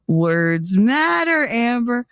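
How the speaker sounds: very drawn-out, rising reproach; slow singsong telling-off, name pitched high; playful scolding. Real, not acted. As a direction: stretch each word as a teasing warning. Playfully scolds commenter for wording.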